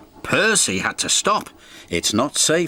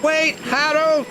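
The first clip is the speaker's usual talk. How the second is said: much higher, slow called-out shout; 14 semitones higher, slower than usual. A narrator's reading. Percy calling Harold before he flies off, shouting. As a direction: call after him across the yard. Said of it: "shouted Percy".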